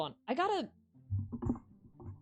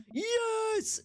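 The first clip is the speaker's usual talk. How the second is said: excited yes. Real, not acted.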